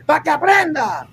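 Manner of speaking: mock macho yell; mock shout. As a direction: act tough for the neighbours. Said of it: "y usted".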